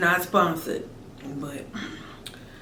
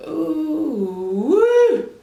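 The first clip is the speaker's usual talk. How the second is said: high happy whoop; delighted squeal; 6 semitones higher, 4 dB louder than usual. Real, not acted. Delight at taste.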